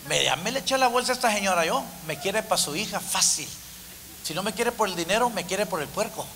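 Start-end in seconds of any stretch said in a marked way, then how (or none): none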